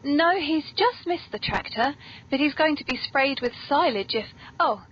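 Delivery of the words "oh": ordinary delivery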